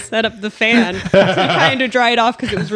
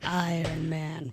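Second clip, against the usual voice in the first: Iron Man voice; 3 semitones lower, 13 dB quieter, much slower than usual. Pretend. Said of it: "That sounded awful".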